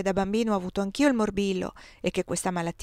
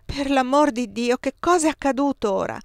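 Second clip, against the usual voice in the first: raised, alarmed voice; frightened question; 5 semitones higher, 4 dB louder than usual. A narrator's reading. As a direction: ask it in sudden fright. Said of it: "domandai spaventata".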